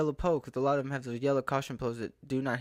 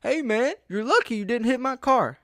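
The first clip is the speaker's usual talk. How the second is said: high, loud call across the lot; louder, higher mock yell at a driver; 9 semitones higher, 5 dB louder than usual. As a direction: yell it from far off, smug. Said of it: "and he yells".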